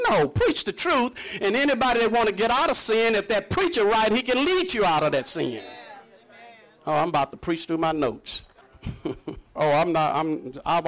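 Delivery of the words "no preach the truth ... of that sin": rising preaching fervor. Real, not acted. Preacher exhorts congregation at climax.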